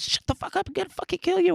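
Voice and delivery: mock a raging nerd in class. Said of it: "dumb glasses nerd, and he goes".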